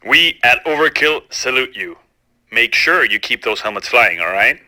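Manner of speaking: deep mock corporate voice; low gruff company spokesman; low put-on voice.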